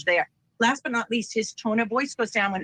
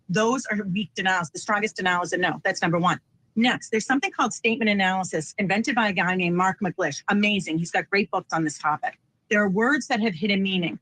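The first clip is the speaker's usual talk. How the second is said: usual speaking voice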